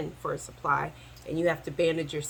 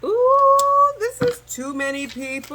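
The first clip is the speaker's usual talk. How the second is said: loud high squeal of shock; surprised; 14 semitones higher, 7 dB louder, slower than usual. Real, not acted. Reader shocked by the many people on the board, loud high squeal. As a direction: react to a crowded spread. Reacting to a crowded card.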